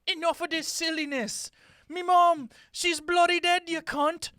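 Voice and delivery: loud cockney outburst; angry cockney prince. Acted. The prince scolding the Doctor over his mother, in angry cockney. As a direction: yell it at him in cockney.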